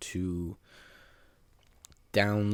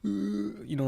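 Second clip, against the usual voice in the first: normal talking voice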